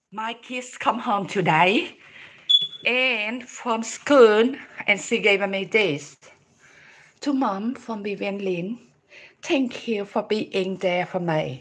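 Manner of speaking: slow, low, careful reading; reading a card aloud. Real, not acted. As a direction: read it out slowly, phrase by phrase. Reads her child's card aloud. (đọc thiệp chậm rãi, giọng trầm)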